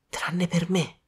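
soft, whispered aside; murmured under breath. A narrator's reading. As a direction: whisper it so hardly anyone hears. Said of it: "mormorò Sara sottovoce". (mormorato sottovoce)

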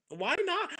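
loud, high-pitched exclamation; playful self-loving outburst; exuberant challenge. Acted. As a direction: throw it out boldly, high and loud. Acts out motto told to friends.

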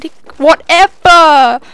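stretched, camp mock of a teammate; a slow, loud, hammed-up camp "whatever". Mocking the teammate with the mic in a camp voice. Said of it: "Not in the camp voice".

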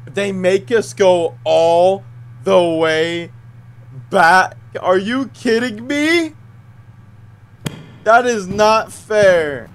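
slow, loud, drawn-out indignation; indignant. Real, not acted. Player sent all the way back, indignant protest. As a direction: protest each word in disbelief, stretched out.

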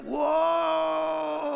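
high, loud amazed whoa; awed crowd whoa. Acted.

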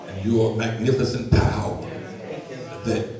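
steady, measured public prayer; earnest prayer. Acted. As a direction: pray aloud before the congregation, deliberate and sincere.

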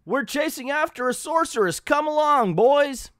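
put-on rally the kids mid-chase, high-pitched; Donald Duck. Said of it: "And he's like".

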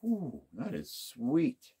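normal talking voice

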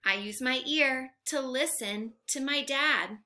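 ordinary talk, nothing marked

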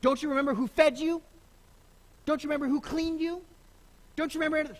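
a hammed-up mother guilt-tripping her son; mock indignant mother. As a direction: play the offended mom, rising. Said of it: "Mary wasn't the one to say".

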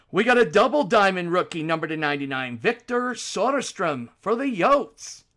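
loud, high, punchy card call; card break announcer. Real, not acted. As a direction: announce the pull with big energy. Calls out cards live.

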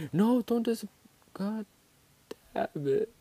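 high, squeaky frustrated protest; high-pitched dismay. Real, not acted. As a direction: squeal in dismay, then curse. Mishap while playing the game.

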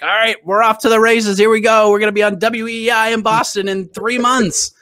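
imitating his overexcited younger self; hyped-up self quote. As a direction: pump it up like it's a done deal. Retold excited self-talk.

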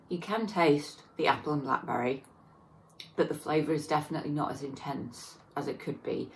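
speaking between bites; chewing while talking. Real, not acted. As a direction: mouth partly full. Seen 1: bite at -1.0; lips closed and working between phrases afterwards.

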